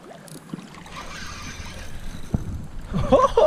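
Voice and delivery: breathless high giggle of joy; high excited laugh. Real, not acted. Fish hooked, thrilled reaction.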